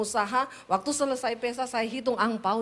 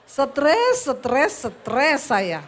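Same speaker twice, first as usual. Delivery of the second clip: normal talking voice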